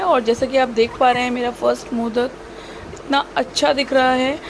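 ordinary talk

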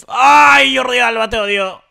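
high loud anguished cry; high anguished yell; anguished outburst. Real, not acted. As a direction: wail at the teammate's mistake. Reacting to teammate's error.

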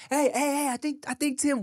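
high-pitched urgent hailing; neighbor calling out. Retold or imitated. Neighbor alerting the driver to dad's waving, urgent call. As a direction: imitate someone trying to get attention. Neighbor acted, flagging attention.

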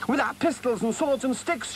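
high-pitched rallying cry; excited shout. A narrator's reading. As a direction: call it out high, joining the battle plan. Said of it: "shouted the Rat".

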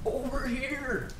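slow, high, distant mimic call; echoing yell. A narrator's reading. Unseen creature mimicking the hunter, slow soft high echo. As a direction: stretch it out like a faint, eerie echo. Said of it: "yell back to me".

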